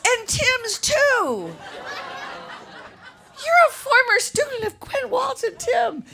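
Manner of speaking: high, slow, gushing mock teacher voice; excited teacher. Retelling the teacher at the show, high gushing imitation. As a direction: imitate the thrilled old teacher. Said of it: "And she goes".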